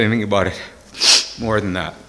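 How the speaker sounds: very soft, low, halting; real choked-up pause to collect himself; overcome with emotion. Real, not acted. Overcome, asks for a napkin.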